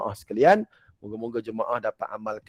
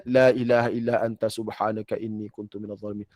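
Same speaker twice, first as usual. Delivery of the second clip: ordinary talk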